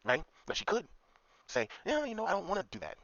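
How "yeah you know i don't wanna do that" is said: put-on higher, mildly hesitant girl refusing; mock girl voice. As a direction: play the girl turning him down, pitched up. Girl's imagined mild refusal.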